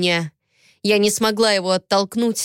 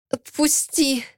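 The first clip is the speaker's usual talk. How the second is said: moaned plea; 5 semitones higher, slower than usual. A narrator's reading. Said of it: "простонала я".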